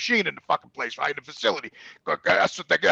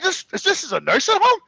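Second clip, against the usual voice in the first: appalled disbelief; 11 semitones higher than usual. Retold or imitated. Appalled at the nursing home.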